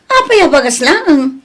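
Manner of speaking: loud, rising why only rice; exasperated mother scolding; indignant question. Acted. Parent scolding over rice only.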